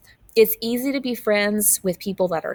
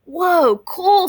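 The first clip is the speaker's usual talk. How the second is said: high, loud thrilled whoa; excited wow; 10 semitones higher, 5 dB louder than usual. Acted. Emilio amazed at the photograph, high and loud. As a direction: let the picture amaze you. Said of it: "says Emilio".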